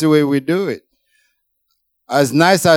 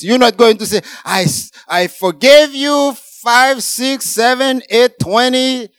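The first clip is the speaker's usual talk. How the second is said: louder, higher, driving the point home; full-force preaching; 8 semitones higher, 4 dB louder than usual. Acted. Pastor rebuking the congregation about counting forgiveness, full-force preaching. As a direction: preach it hard, slowing on the key lines.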